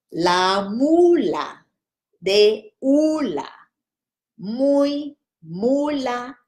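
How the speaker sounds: very slow, syllable by syllable; slow practice. Shown as a demonstration. Teacher repeating the twister very slowly for students. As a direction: drill the twister at half speed. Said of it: "Let's try it again".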